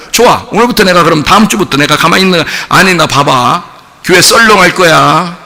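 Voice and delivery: usual speaking voice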